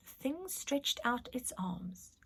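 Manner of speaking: slow breathy whisper; spooky whisper. A narrator's reading. Narrator reading the spooky moment in a slow whisper. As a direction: hush it like a ghost story.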